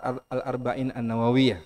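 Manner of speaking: normal talking voice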